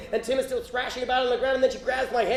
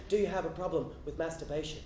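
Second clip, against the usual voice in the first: low, soft confidential question; close in the ear; 6 semitones lower, 9 dB quieter than usual. Retold or imitated. Church lady leaning in to a boy's ear, low and hushed. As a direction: ask it hushed, right into their ear. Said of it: "leans in close to my ear and says".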